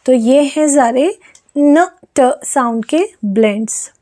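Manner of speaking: ordinary delivery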